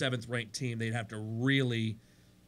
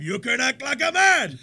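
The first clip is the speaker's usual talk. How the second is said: Godfather impression; 10 semitones higher, 10 dB louder than usual. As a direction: bark it like a mafia boss slapping a weak singer. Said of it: "I think all the time".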